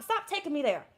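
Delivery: normal talking voice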